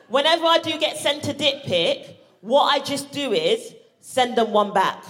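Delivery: ordinary delivery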